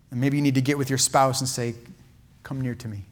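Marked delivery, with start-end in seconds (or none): 2.4-3.1 soft invitation. Shown as a demonstration. Telling spouse, come near.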